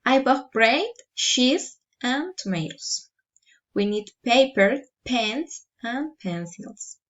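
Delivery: "bread", "cheese", "paper", "pens" lifted, last item dropped; rising list intonation. Shown as a demonstration. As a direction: lift each item, let "tomatoes" and "pencils" fall. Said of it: "Rising intonation".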